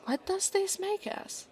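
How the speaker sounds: high, light, probing question; tentative question. Acted. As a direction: ask it pitched well up, feeling out the answer. Asks about their new relationship.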